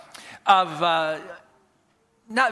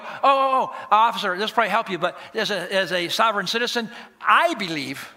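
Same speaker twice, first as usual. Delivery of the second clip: self-important protest. Acted. Speeding driver to police officer, mock self-important protest.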